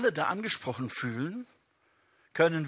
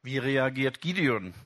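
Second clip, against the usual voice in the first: ordinary delivery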